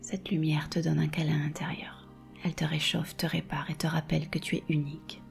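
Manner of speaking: whisper. Acted. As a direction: repeat it as a hushed whisper. Repeats the phrase, now whispered.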